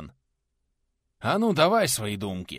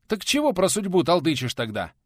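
higher, louder irritated retort; 9 semitones higher, 6 dB louder than usual. A narrator's reading. Teacher snaps back at Милован, irritated. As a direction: snap back, fed up. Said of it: "Раздражённо отвечал преподаватель".

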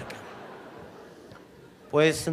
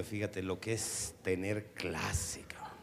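soft, slow whisper; whispered aside; 5 semitones lower, 11 dB quieter, slower than usual. Acted. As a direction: lean in and whisper it. Confiding aside to audience.

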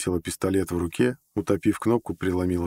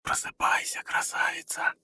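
soft unvoiced whisper; 6 dB quieter than usual. A narrator's reading. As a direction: whisper gently to wake someone sleeping. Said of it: "тихонько прошептал". (нежный тихий шёпот)

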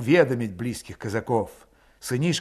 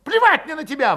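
high, loud, defiant outburst; angry yell; 13 semitones higher, 7 dB louder than usual. A narrator's reading. Valet spitting defiance at Grigory's officer airs, yelling. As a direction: yell back in a high pitch. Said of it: "выкрикнул Валет".

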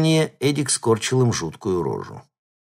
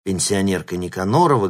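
ordinary delivery, nothing marked

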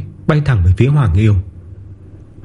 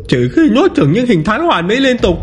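monster muttering; 12 semitones higher than usual. Acted. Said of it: "Hoàng Yêu lẩm bẩm".

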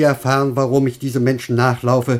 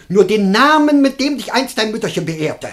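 much higher and loud, imploring; pleading cry; 11 semitones higher, 5 dB louder than usual. Acted. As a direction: beg insistently for the name. Master begs for the name.

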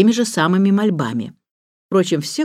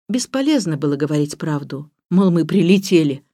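ordinary delivery

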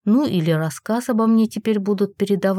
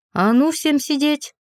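sharp bark; 6 semitones higher than usual. A narrator's reading. Heroine ordering fleeing fairies to stay, sharp bark. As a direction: snap the order at the whole room. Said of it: "рявкнула я резко".

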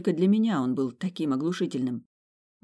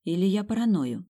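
usual speaking voice

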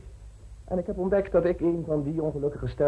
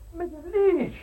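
calling out; slower than usual. Acted. Calling after departed landlady.

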